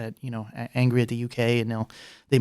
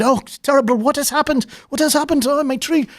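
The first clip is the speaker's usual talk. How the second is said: hammed-up shock in a much higher, louder voice; mock dismay; 13 semitones higher, 4 dB louder than usual. Neighbour faking grief to the council over his tree, hammed-up high wail. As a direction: fake lament, pitched way up.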